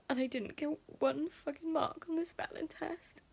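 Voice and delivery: fighting back tears; real upset, on the edge of crying; tearful. Real, not acted. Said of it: "I know I'm getting fucking emotional".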